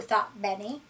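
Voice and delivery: usual speaking voice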